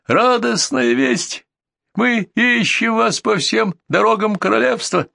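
high-pitched herald's cry; elated messengers calling out, pitched high; excited shouting. A narrator's reading. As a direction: call out joyful news to the king. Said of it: "закричали гонцы".

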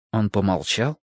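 ordinary delivery, nothing marked

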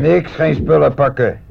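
slow, loud and emphatic; firm refusal. Acted. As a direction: put your foot down hard. Sergeant overrules packing up.

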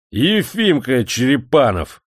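громко, медленно, с досадой; отмахнуться раздражённо, чеканя имя; сердито. Acted. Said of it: "сердито отмахнулся помещик". (помещик сердито отмахивается от гостя)

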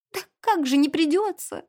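high plaintive maid's question; tearful. A narrator's reading. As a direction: protest on the verge of a sob. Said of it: "всхлипнула Энн". (жалобно, всхлипывая)

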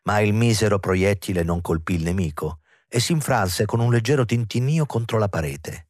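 usual speaking voice

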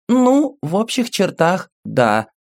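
put-on high, bright child voice; little girl voice. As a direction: speak as a small girl telling her story. Little girl character speaking.

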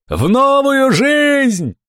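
very high, very slow holler; distant drawn-out shout. A narrator's reading. Fat man shouts from the forest, drawn out. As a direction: stretch every word into a long call. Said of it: "раздалось из леса".

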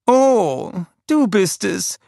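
high queenly voice; old queen. A narrator's reading. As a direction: recognise him with mild disdain. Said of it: "sagte sie". (hohe Stimme der alten Königin)

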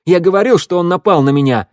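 loud, high-pitched accusation; flaring up. A narrator's reading. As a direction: insist hotly and loudly. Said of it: "вспыхнул Раду". (запальчиво, громко)

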